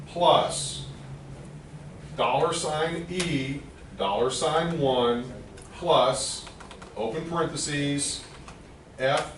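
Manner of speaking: slow, spaced-out dictation; dictating. Shown as a demonstration. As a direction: read each symbol out slowly for typing. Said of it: "I will read it out to you, and you can type it in".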